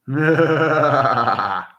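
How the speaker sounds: long, high, loud cackle; loud laugh trailing downward. Real, not acted. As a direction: burst into a big drawn-out laugh. Laughs after his own remark.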